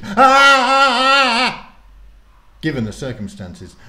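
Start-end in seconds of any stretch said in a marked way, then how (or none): 0.0-1.8 put-on high piercing girl's scream. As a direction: let out a sudden terrified shriek. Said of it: "Rose screamed".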